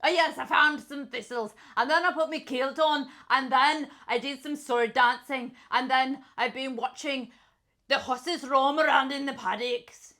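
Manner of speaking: put-on angry-sounding Scottish accent. Player listing a Highland day, angry Scottish accent. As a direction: keep up the riled Scot. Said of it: "You're so angry as a Scottishman".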